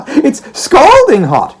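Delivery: loud, high and slow gleeful boast. Acted. Daughter teasing her father, gleeful and slow. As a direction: savour every word with delight. Meg's glee.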